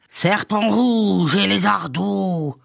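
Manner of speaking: slow, high-pitched shrieked incantation; old witch wailing her spell; yelping witch. A narrator's reading. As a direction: screech out a curse, stretching the words. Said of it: "Elle se mit à glapir".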